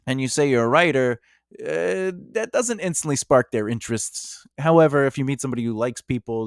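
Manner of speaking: normal talking voice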